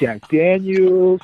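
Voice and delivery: very slow, high drawn-out offer; mock eager-to-please pledge. Wrestler offers veterans a drink, slow high mock-servile offer. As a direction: stretch the drink's name, fawning playfully. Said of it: "My man out here pledging".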